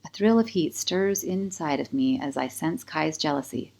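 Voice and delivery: ordinary delivery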